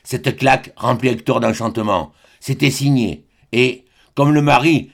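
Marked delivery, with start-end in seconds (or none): none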